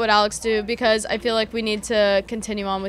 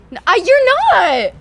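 high, loud, drawn-out disbelief; disbelieving shout; 11 semitones higher, 10 dB louder, slower than usual. Real, not acted. Refusing to believe the guest, disbelieving shout. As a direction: shriek it out in shock. Disbelieving reaction to being lied to.

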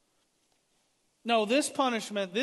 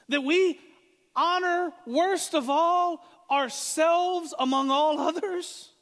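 rising, louder and higher, slowing on the last words; full-force preaching; 8 semitones higher, 5 dB louder than usual. Acted. Preacher challenging the congregation, full-force preaching. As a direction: thunder each question at the congregation.

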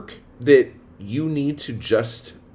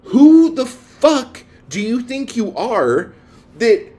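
angry rant; 8 semitones higher, 7 dB louder, slower than usual. Real, not acted. Ranting at complaining male writers.

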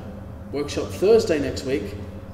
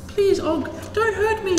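much higher, louder pleading little voice; frightened princess; 4 dB louder than usual. Acted. Aurora begging Og the monster for mercy, high and frightened. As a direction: beg the monster not to hurt you, high and scared. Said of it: "She says".

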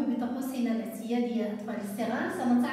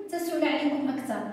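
normal talking voice

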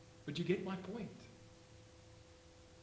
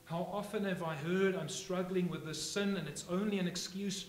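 loud, raised sermon voice; fiery preaching; 4 semitones higher, 7 dB louder than usual. Acted. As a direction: drive the questions home to the congregation. Preaching at full force.

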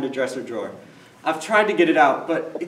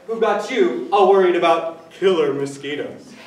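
usual speaking voice

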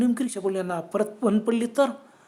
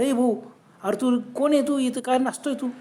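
higher-pitched, demanding questioning; challenging call; 4 semitones higher than usual. Acted. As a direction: confront a stranger acting oddly. मोटरसायकलस्वार राजूला जाब विचारतो. (जाब विचारणारी हाक)

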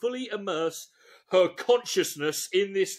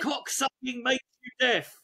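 slow, forceful stern put-down; deliberate, heavy, commanding delivery; 5 semitones higher than usual. Acted. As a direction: shut him down, word by word. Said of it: "in a low and slow, ster-, but stern voice".

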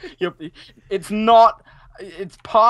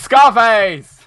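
slow, loud announcement; drawn-out triumphant shout; excited call-out; 6 semitones higher, 11 dB louder than usual. Real, not acted. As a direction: stretch it out and belt it. Calling out the character name.

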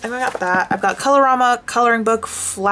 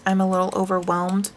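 ordinary talk

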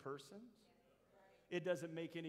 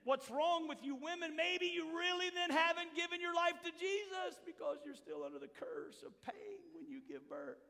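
sarcastic mock accusation; 10 semitones higher than usual. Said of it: "we have to say then".

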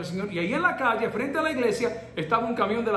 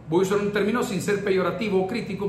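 ordinary talk, nothing marked

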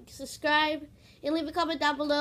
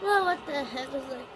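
ordinary delivery

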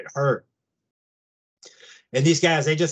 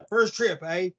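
higher-pitched mock British accent; 4 semitones higher, slower than usual. Retelling the rancher's question to the newcomer, imitated British accent. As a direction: imitate a Brit, lightly and higher. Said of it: "he had a British accent".

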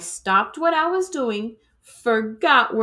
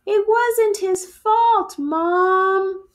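put-on higher, louder pleading child; pleading kid; 10 semitones higher, 4 dB louder, slower than usual. Fly pleads with her mom that Spider is not to blame. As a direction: plead with your mother to let it go.

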